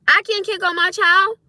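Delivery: high, loud, incredulous challenge; indignant disbelief. Real, not acted. Parent challenges critics about disciplining child, indignant. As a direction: throw it back as an outraged question.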